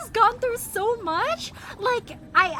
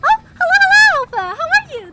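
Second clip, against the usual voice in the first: loud excited greeting; 8 semitones higher, 15 dB louder, faster than usual. Real, not acted. Greeting a new chat viewer.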